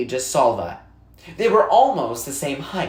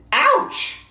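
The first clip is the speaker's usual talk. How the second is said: sharp high startled yelp; sudden ouch; 3 dB louder than usual. A narrator's reading. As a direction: jolt up on ouch as if stepped on. Narrated sudden pain exclamation.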